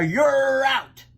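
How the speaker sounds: high, loud umpire call; umpire's cry. A narrator's reading. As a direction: shout the verdict for everyone. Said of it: "cried the umpire".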